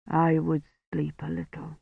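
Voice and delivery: soft, fading, drowsy voice; weary. A narrator's reading. Sick priest to Kivrin, faint drowsy murmur. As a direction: let it sink quietly towards sleep.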